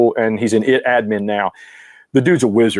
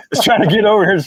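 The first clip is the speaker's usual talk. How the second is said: laughing; 7 semitones higher than usual. Real, not acted. Laughing apology while chasing moth.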